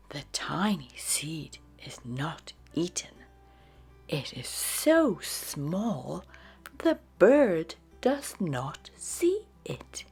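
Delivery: usual speaking voice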